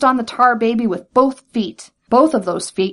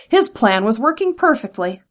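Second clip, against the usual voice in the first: usual speaking voice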